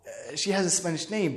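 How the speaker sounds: soft, low, hesitant concession; nervous. Retold or imitated. Son answering his father's doubt, sheepish and low. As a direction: concede it quietly and uneasily. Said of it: "Then I start sound like we nervous".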